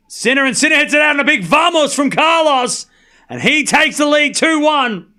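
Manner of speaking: high, loud commentator's climax; excited yelling. Real, not acted. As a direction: yell the big point like a sportscaster. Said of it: "why you are yelling".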